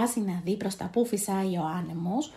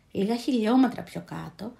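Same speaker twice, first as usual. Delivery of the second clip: ordinary delivery, nothing marked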